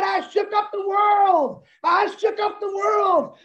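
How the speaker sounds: high, drawn-out triumphant proclamation; Muhammad Ali. Retold or imitated. Boxer after beating Sonny Liston, high triumphant proclamation. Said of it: "Muhammad Ali ... he said".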